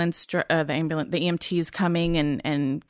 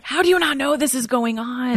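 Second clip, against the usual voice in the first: frustrated; 7 semitones higher, 3 dB louder than usual. Retold or imitated. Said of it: "I was so frustrated".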